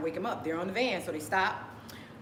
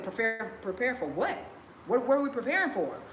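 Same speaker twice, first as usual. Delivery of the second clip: retold protest, pitch climbing; incredulous questioning; 5 semitones higher, 3 dB louder than usual. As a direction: question it in disbelief, getting louder and higher. Retelling own protest to family.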